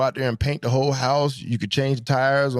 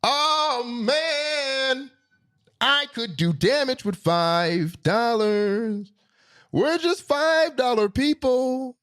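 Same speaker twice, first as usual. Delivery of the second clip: very high, slow, drawn-out cry; exaggerated elation; 14 semitones higher, much slower than usual. Driver reliving what five dollars once bought, high drawn-out elation. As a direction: stretch every word high in mock rapture.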